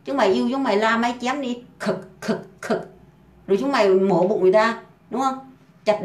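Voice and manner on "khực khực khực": whispered, clipped mouth sounds of a blade hacking; unvoiced mock chopping sound effect; chopping noises. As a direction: hack out the sounds of chopping, breathy and short.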